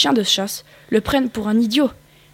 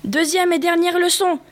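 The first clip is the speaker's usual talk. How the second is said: high, bossy announcing; professor shouting; 8 semitones higher than usual. A narrator's reading. Rabbit teacher calling out the final lesson, high and bossy. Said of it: "hurle le professeur".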